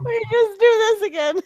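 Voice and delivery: high, fast, words through laughter; cracking up mid-sentence; laughing. Real, not acted. Laughing fit.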